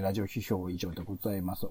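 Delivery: normal talking voice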